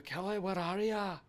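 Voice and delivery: calling out in a high, slow, hammed-up Scottish accent that drifts toward Irish; bad Scottish accent. Calling out to co-streamer Kelly in a bad Scottish accent. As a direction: stretch it out like a bad stage Scot. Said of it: "bad Scottish accents all the time".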